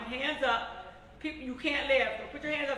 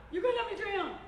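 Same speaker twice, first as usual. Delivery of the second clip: mock panic; 9 semitones higher than usual. Panicked retold pool quarrel.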